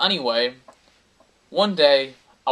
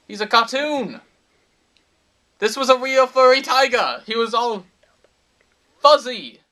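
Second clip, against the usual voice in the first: high, loud mock-bewildered protest; incredulous insistence; 8 semitones higher, 8 dB louder than usual. Storyteller arguing that this tiger was no cartoon, incredulous insistence. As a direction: insist on it, pitched right up and loud.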